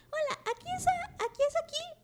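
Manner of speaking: voz de niñita de títere mucho más aguda; voz de niña. Retold or imitated. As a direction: pregunta con vocecita de niña inocente, muy lento al final. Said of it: "animen esta voz". (vocecita aguda de niña de Plaza Sésamo)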